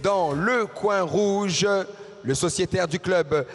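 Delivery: ordinary delivery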